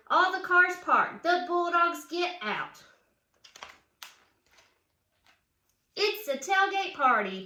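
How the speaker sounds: lively read-aloud storybook voice; reading to children. A narrator's reading. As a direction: read it like a picture book for kids. Reads picture book aloud.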